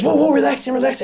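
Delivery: urgent high calming doctor, imitated; doctor calming patient. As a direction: hush the jolting patient in a high rush. Doctor calming flopping leg.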